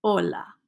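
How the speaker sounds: hola as "ola", no breath on the H; silent H in hola. Shown as a demonstration. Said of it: "Don't pronounce H's in Spanish".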